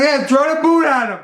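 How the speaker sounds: high, loud acted order; urgent insistence. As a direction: urge him on at full volume. Urging husband on, acted dialogue.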